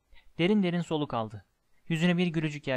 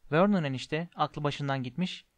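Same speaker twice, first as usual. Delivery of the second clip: ordinary delivery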